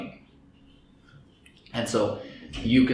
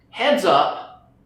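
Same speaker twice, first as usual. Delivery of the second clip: alert call; 6 semitones higher, 5 dB louder than usual. Acted. Emotional center raising alarm.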